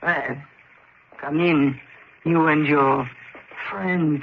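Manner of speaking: gruff concierge. Acted. Grudging concierge lets them in.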